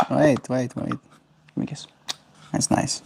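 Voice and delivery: very soft, near-whispered murmur; hushed affection. Real, not acted. Owner asking bird for a kiss, hushed murmur. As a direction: whisper it close to a pet.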